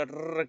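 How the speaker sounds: "R" as a quick rolled trill; rapid Spanish R. Shown as a demonstration. Teacher showing what it is not, trilled Spanish "R". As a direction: roll the "R" fast on the tongue tip. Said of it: "No es el sonido rápido".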